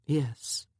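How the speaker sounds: usual speaking voice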